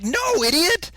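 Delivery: high, loud exasperated retort; mock exasperation. Kid correcting gullible people about a joke, loudly exasperated. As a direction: scold them in a squawking mock outrage. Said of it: "I'm like".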